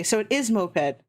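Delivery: ordinary talk, nothing marked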